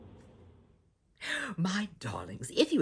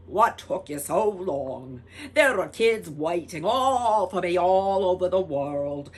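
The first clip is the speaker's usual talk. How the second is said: grouchy Tooth Fairy; 3 semitones higher, 11 dB louder than usual. Acted. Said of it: "grouchy fairy".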